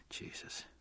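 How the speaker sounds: a breathless whispered Jesus; whispered oath. A narrator's reading. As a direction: let it slip out as a soft whisper. Overwhelmed thinking of Angela.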